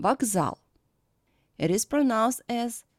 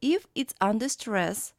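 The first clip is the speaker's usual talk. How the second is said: ordinary talk, nothing marked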